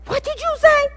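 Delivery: high, sharp demanding question; angry mom. Retold or imitated. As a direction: demand it like a suspicious mother. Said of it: "and she said".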